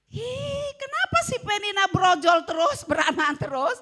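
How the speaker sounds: high, loud mocking shout with laughter; mock the rival with a laughing shriek; jealous gossip. (meniru perempuan cemburu berteriak sambil tertawa)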